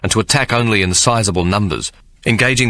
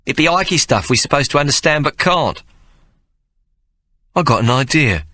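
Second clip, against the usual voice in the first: put-on much higher boy voice, aggrieved; a young lad objecting with a rough street grammar; protesting boy Hawk; 6 semitones higher than usual. Hawk protesting to Mary, high aggrieved boy voice. Boys talk.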